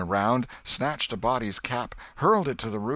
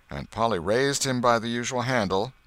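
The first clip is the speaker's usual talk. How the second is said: ordinary delivery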